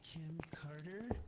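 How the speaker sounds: slow whispered call. Pretend. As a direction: breathe the name, slowly. Whispering to the ghost.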